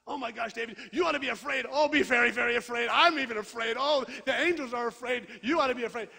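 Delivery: loud, high mock panic; hammed-up alarm, almost yelling; panicky Jesus. Preacher imagining Jesus panicking at David's fear, mock loud panic. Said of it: "what do you think Jesus is doing?".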